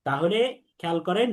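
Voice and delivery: ordinary delivery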